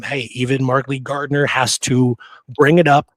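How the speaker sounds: usual speaking voice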